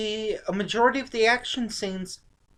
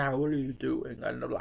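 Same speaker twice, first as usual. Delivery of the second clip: put-on low, quiet Connery voice with no Scottish accent; Connery without the accent; 8 semitones lower, 9 dB quieter, faster than usual. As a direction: drop the Scottish, keep the low murmur. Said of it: "went back to talking like this".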